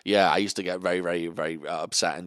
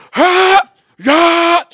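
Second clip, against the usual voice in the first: loud high karate shouts; mock martial-arts battle cries; karate yells; 15 dB louder than usual. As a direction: bark out karate kiai cries.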